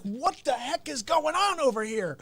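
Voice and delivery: high, put-on incredulous outburst; mock bewilderment. Boyfriend reacting to Walken-voiced girlfriend, mock bewilderment. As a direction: react in exaggerated disbelief.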